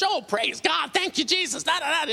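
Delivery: very high mock praise shouting; imitated church rejoicing. Women having church in the hallway, imitated high-pitched rejoicing. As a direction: mimic ecstatic Pentecostal worshippers. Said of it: "are in the hallway having church".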